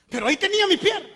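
high and quick, proudly insisting; high, fast proud insistence; emphatic triumph. Real, not acted. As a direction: push it up fast like a small victory.

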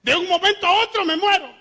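high, loud mock despair; high and loud, hammed-up dread. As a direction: ham up a teenager sure he will die any moment. Retold youthful dread of dying.

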